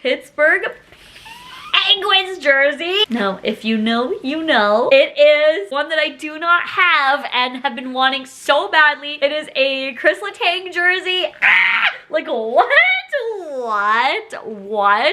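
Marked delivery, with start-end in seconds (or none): 0.0-3.0 slow, higher, rising excited reveal. Real, not acted. Revealing dream jersey gift. 11.4-11.8 loud shriek of joy; excited scream. Real, not acted. Screaming at jersey gift. 12.1-15.1 rising shouted whats; can't believe it; stunned disbelief. Real, not acted. Disbelief at jersey gift.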